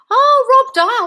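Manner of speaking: loud, high exclamation; surprised recognition. Real, not acted. Coach reading a viewer's suggested name, loudly surprised. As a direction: exclaim as the name rings a bell.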